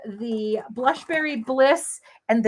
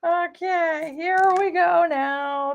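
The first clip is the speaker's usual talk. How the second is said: usual speaking voice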